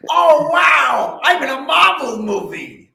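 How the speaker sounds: very high, loud mocking yell; hammed-up, piercingly high celebrity mockery. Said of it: "I don't like her voice".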